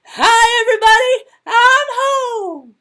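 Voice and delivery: very high, very loud cry; volume turned right up. A narrator's reading. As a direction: blast a greeting through the building. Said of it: "she turns it right up".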